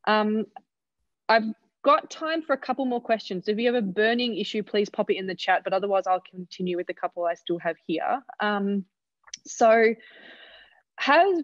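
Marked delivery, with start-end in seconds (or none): none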